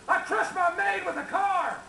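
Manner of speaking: high-pitched mock scream; screamed confession. Trapped Marvin confessing in the mine, mock screaming. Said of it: "Marvin started screaming".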